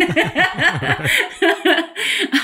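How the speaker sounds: bright burst of laughter; amused laugh. Real, not acted. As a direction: laugh at the memory. Laughing at her teen self.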